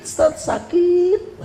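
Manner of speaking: a high, slow, drawn-out whine on sakit; hammed-up lament of a hurt parent; wailing lament. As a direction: stretch the pain out like a mock wail.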